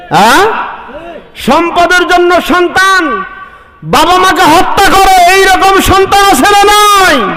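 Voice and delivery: high, ringing sermon cry; fiery preaching. Acted. Preacher calling to the congregation, fiery and high. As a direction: preach it out to the crowd at full force. Preacher rises to full force.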